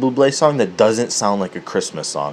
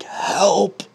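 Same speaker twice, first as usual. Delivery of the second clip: high little cry for help; lightly voiced, high-pitched plea; pleading squashed balls; 9 semitones higher than usual. Comedian voicing squashed balls, high mock plea. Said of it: "his balls were like".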